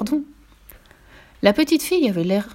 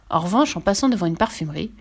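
ordinary delivery, nothing marked

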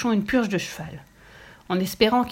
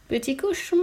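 Rachida; 6 semitones higher than usual. A narrator's reading. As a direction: echo the question in a small high voice. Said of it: "répéta Rachida". (petite voix aiguë qui répète doucement)

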